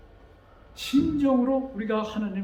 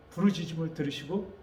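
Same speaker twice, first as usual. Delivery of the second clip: normal talking voice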